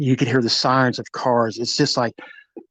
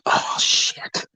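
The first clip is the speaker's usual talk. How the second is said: breathless whispered curse under the breath; a hushed, shocked whisper to yourself; whispered oh shit. Retold or imitated. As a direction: mutter it to yourself in a stunned whisper. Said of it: "And I was like".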